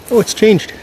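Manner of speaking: sudden high surprised oh. Real, not acted. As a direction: caught off guard, pitch jumps up. Finding the structure changed.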